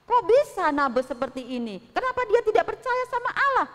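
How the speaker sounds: shocked at the accused; outraged rulers. Acted. Semuanya marah.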